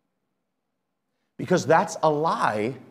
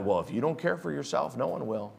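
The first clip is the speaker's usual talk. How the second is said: quiet dismissive quote; 10 dB quieter than usual. Shown as a demonstration. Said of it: "a lie from the devil".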